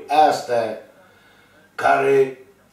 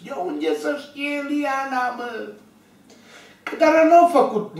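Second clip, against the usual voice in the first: shouted much higher and slowed; throwing the question out in disbelief; exasperated exclaim; 10 semitones higher than usual. Real, not acted. Asking viewers how Liana could know nursing, high exasperated shout.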